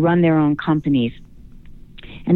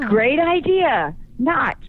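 high, drawn-out mock praise; mock enthusiasm, then a dry negation; sarcastic; 7 semitones higher, slower than usual. Coach mocks men's novelty ties, sarcastic.

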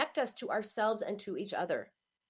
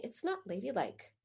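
half-whispered, softer quoted scolding; retold whisper; 7 dB quieter than usual. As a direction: hush it like a disapproving remark passed on. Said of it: "someone was just telling me".

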